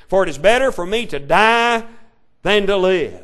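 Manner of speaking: loud, ringing preacher's read of Jonah's plea; pleading prayer read at full force; fervent scripture reading. Acted. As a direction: proclaim it from the pulpit. Reads Jonah's prayer aloud.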